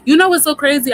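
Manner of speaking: sudden excited outburst to the chat; excited exclamation. Real, not acted. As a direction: blurt it out, thrilled. Amazed reaction to a viewer's comment.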